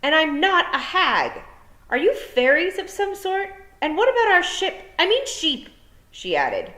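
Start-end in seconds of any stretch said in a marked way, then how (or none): 0.0-5.7 indignant shout. A narrator's reading. Tiffany shouting questions at the vanished fairies, loud. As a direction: call it out into the empty yard.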